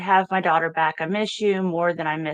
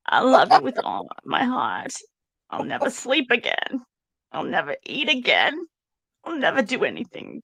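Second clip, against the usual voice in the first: higher, hammed-up mock of Donna's gushing tone; 6 semitones higher than usual. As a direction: overdo it like a melodramatic mother's message. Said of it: "I need to do this in the Donna voice".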